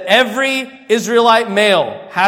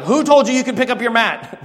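higher, indignant mock demand; irritated leaders; 6 semitones higher, 4 dB louder than usual. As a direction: play the annoyed leader, pitched up. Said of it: "the leaders got all irritated".

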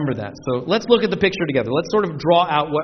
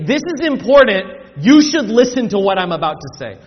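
raised, loud "This is important"; emphatic declaration; 6 dB louder than usual. Retold or imitated. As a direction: demand attention on "This is important" and "You should listen". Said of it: "It is emphatic".

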